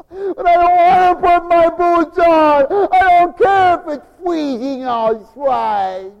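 put-on high, loud, slow whining kid; whiny child. Child refusing boots, high whiny protest. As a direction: whine like a stubborn little kid refusing.

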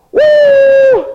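high loud whistle cry like a steam train; train whistle woo. Acted. The train nears home, a high whistle woo.

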